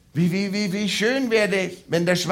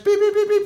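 high mock beeps from above; high beeping; 13 semitones higher than usual. God beeps down from heaven, high. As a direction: chirp it like a distant signal.

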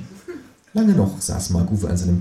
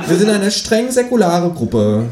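high, slow, louder creature voice; Känguru; 9 semitones higher, 5 dB louder than usual. A narrator's reading. Kangaroo describes its group to companion, slow high voice. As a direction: stretch it out earnestly, pitched high. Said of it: "sagt das Känguru".

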